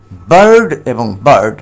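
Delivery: first বার্ড long, second বার্ড short; teacher contrasting long and short vowels; long then short vowel. Shown as a demonstration. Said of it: "long sound, short sound".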